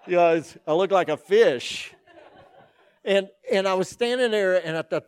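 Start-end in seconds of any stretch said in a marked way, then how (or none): 0.1-1.9 loud, laughing through the words; laughing at how silly he looked. Real, not acted. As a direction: crack up at your own story. Laughing at himself.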